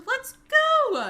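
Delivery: high, loud cheer; excited cheer. Real, not acted. Celebrating resub.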